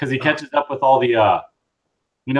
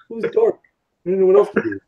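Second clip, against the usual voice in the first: higher, lightly voiced dopey Vulcan; goofy Vulcan voice; 6 semitones higher, faster than usual. Retelling the cartoon, a higher goofy Vulcan voice. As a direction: play the clueless primarch, a touch silly. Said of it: "he's like".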